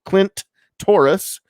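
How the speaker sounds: very slow name, a clear pause between its two words; split up, slow. Real, not acted. Host repeats his favorite screen name ever, split up and slow. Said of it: "split that up and say it a little bit slowly".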